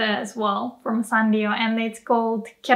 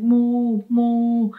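complaining moo. Retold or imitated. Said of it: "they're complaining or they're frustrated".

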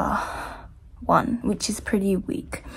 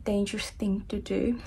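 usual speaking voice

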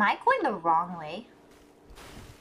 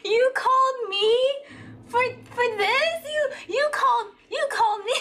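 incredulous; 13 semitones higher than usual. Real, not acted. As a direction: squeal in outraged disbelief. Friend calls to say it's hopeless.

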